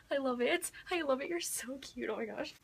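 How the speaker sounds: soft, lovesick gush; gushing softly. Real, not acted. Fangirl gushing softly about her book crush. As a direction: melt over him, hushed.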